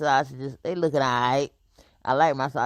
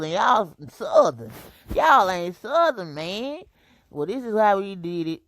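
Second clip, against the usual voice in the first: slow, emphatic teasing callout, pitch up; drawn-out playful jab at the viewers; mock scolding; 5 semitones higher, 3 dB louder than usual. Real, not acted. To viewers who don't know corned beef hash, slow mock scolding.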